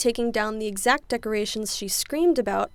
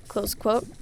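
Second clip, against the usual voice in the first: ordinary talk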